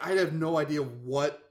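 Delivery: normal talking voice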